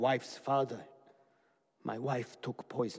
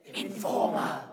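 ghostly whispered echo; shimmering echoing mirror voice; 4 semitones higher, 3 dB louder than usual. Acted. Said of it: "the mirror said".

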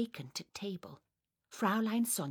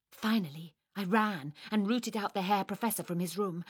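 plain reading voice

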